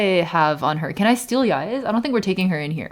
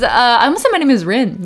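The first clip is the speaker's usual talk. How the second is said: ordinary delivery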